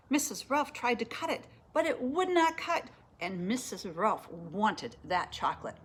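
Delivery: ordinary delivery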